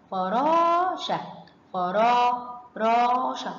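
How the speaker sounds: slow فراشة with a long held raa; long vowel demo. Shown as a demonstration. Teacher lets pupils hear the madd, drawn-out فراشة. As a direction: stretch the alef in فرا and راشة. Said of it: "سمعنا صوت المد".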